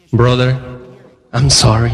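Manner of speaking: low, awkward retold sympathy; mock condolence. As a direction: imitate a coworker offering sorry, low. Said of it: "saben que te van a despedir".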